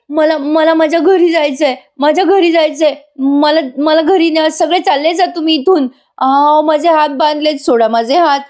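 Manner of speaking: high, mock distressed yelling; Suman. Suman pretending trauma, demanding to go home, mock distressed cries. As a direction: plead in an acted, high-pitched panic. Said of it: "Suman मोठ्याने ओरडते".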